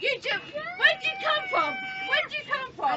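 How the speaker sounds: high, loud yelling demand; real angry shout on a tram; furious shout. Real, not acted. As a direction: shout her down with the question. Tram row, demanding her origin.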